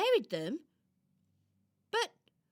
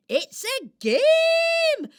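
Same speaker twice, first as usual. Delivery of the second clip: much higher, louder, slow gleeful cry; excited squeal; 13 semitones higher, 10 dB louder, much slower than usual. Acted. Squirrel bursting to explain her favourite game, squealing. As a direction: burst out with delight.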